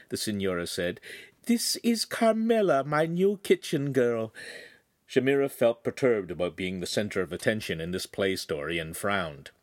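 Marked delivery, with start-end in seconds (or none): 1.5-4.3 much higher, gently refusing lady; a refined lady politely declining; signora's voice. Acted. Signora turning down the butcher's beef heart, much higher.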